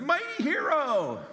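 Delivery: high, slow proclamation; grand angel greeting. Acted. Angel greets frightened Gideon, grand and slow. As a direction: hail him like a herald.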